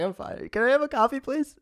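pitched high, forced brightness to mask crying; hiding tears. Retold or imitated. Crying passenger to stewardess, covering tears in a strained high voice. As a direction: squeak out a fake-fine request. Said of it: "I was like".

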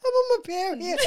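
high, loud tearful confession; laughing-crying. Real, not acted. Host explaining the tears to co-host, laughing-crying wail. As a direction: wail the excuse through laughter.

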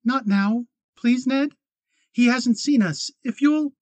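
put-on pleading young woman. A narrator's reading. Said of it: "Kate pleading with Ned".

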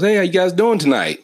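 high, loud fake-polite greeting from the big brother; mock friendly big brother. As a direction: play the brother acting nice but menacing. Brother Victor voiced in story.

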